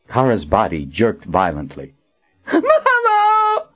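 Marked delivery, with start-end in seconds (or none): 2.5-3.7 piercingly high, terrified shriek. A narrator's reading. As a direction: shriek for mother like a helpless child. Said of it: "she shrieked".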